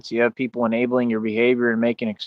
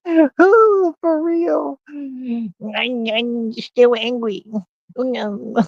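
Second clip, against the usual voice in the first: very high, squeaky mock voice; hammed-up high-pitched whine, slowed on still angry; mock whiny voice; 11 semitones higher, much slower than usual. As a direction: squeak it out like a sulky hater. Mocking voice after a laugh.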